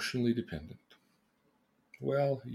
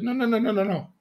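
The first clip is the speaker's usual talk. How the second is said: higher, earnest pleading brother; imitating an eager objection, pitched up; Jesus; 7 semitones higher, 3 dB louder, faster than usual. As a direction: protest gently, tumbling over the words. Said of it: "And his brother said".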